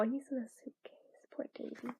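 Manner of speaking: whispered, soft and sorry; pitying whisper. Acted. Reading a character pitying Davy, hushed whisper. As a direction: breathe it out like a sad secret.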